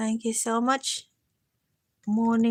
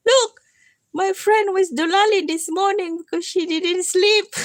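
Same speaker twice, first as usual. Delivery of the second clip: hint of a high, loud announcement; reporting to mom; 6 semitones higher, 5 dB louder than usual. Friend voicing what Susan should tell mom, raised announcing tone. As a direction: tell mom with mock exclamation. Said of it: "and say".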